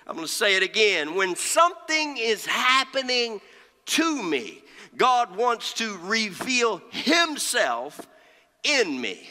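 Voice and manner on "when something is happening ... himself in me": high opening, falling to a hushed close; emphatic preaching. Real, not acted. Preacher giving the congregation the key point, emphatic preaching. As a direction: drive the point home with long pauses.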